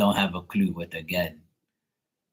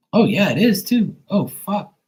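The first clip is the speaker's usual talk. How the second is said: startled; 10 semitones higher, 5 dB louder than usual. Real, not acted. Startled by lightning storm.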